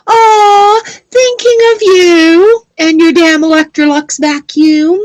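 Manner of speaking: high, slow, fond teasing; sweet, sing-song fond tease; fond mock sweetness. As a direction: coo at grandma with affectionate exasperation. Addressing late grandma fondly.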